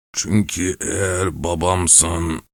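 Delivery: put-on whining old man. A narrator's reading. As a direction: whimper it like a frail old man. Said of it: "diye devam etti sızlanarak".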